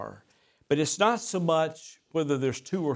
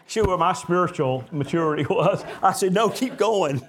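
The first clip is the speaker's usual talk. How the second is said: laughing retelling; 5 semitones higher, 3 dB louder than usual. Retold or imitated. Retold exchange, laughing.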